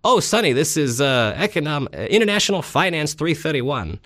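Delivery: a hint of an older New York professor's accent. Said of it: "he said it in his accent".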